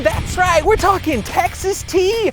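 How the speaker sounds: high, loud, drawn-out mock celebration; hammed-up hype. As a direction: whoop it up like an over-the-top hype man. Hyped-up comic reveal of oil.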